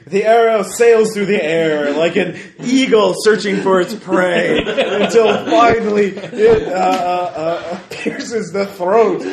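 slow, grand storyteller build; dramatic narration. A narrator's reading. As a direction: paint the kill like an epic.

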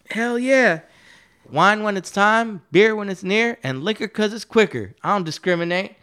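high, rowdy imitation of a proud drunk; wasted old man. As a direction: play the wasted jailbird reciting his motto. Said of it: "that came in wasted".

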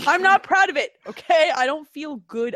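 high, loud, indignant insistence; defensive protest. Real, not acted. Mock defensive confession to co-host.

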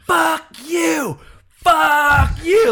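high, drawn-out mock screech; mock yelling. Imitating a woman cursing him from her car, mock yell. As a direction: imitate an enraged driver screeching. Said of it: "yelling about something".

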